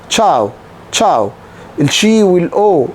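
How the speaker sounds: normal talking voice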